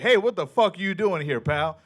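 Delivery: lightly voiced cop challenging a stranger; aggressive cop. Cop confronting a man at a bus stop, aggressive. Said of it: "two cops just rolled up on me".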